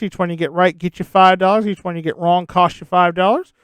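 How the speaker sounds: ordinary delivery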